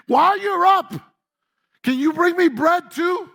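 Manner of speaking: high, loud call to another room; yelling to the kitchen. Retold or imitated. Elijah calling out to the widow in the kitchen, loud high yell. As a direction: shout it out like you're calling from the next room. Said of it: "he's yelling out into the kitchen".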